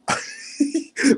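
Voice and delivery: real high burst of laughter; laughing. Real, not acted. Reacting to a viewer's comment, bursting out laughing.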